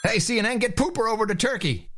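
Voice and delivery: high, comic calling-out voice; mock newsroom order. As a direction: call it out high like a hammed-up boss. Mock order called to CNN.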